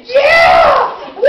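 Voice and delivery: loud, high whooping yell; performers shouting. Retold or imitated. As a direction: shout like subway performers hyping the car. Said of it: "Subway performers come out and say".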